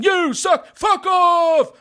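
high, loud terrace-style heckle; British crowd shouting. Retold or imitated. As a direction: yell abuse across the arena in British English. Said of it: "driver de og roper til hverandre".

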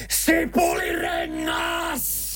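stretched high roar, very slow; roaring shout. Shown as a demonstration. Radio host demonstrating a roar for the contest, stretched high shout. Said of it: "Mä huutaisin".

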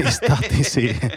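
real laughter in the voice; laughing. Real, not acted. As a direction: laugh through the punchline of a prank. Laughs while telling school prank.

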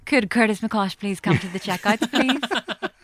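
tannoy announcer voice; supermarket announcement. Retold or imitated. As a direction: page staff over the shop speaker. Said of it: "I absolutely loved going".

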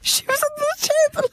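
high, laughing squeal. Real, not acted. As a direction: say it through giggles. Laughing at the revelation.

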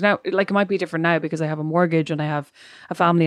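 usual speaking voice

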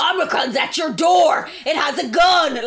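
slow, loud, high panic cry; mock alarm. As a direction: shout like a scaremonger headline. Parodying media panic.

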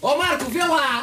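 calling out. Real, not acted. Calling out to a colleague.

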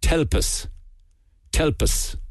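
Limerick clipped "Telp us", God swallowed into a breathy T; Limerick pronunciation. Shown as a demonstration. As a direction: say "Telp us" the Limerick way, whispered quick. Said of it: "in Limerick they say".

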